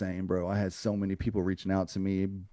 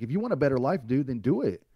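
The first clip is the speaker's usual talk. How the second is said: tough love; 5 semitones higher than usual. Retold or imitated. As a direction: chew him out like a drill sergeant. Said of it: "giving me some tough ass love".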